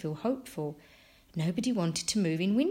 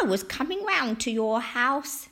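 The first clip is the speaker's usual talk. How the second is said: put-on high, beaming old-lady voice; Missus Cox; 6 semitones higher, 4 dB louder than usual. A narrator's reading. Missus Cox greeting Franklin at her door, beaming. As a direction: greet him in a pitched-up character voice. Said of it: "Missus Cox beamed".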